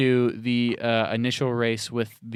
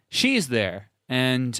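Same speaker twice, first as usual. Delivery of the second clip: ordinary talk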